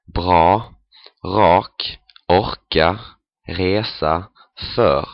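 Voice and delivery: bra, rak, orka, resa, för with R back in the throat; German or Danish style throat R in bra, rak, orka, resa, för; guttural R. Shown as a demonstration. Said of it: "a guttural R, like this".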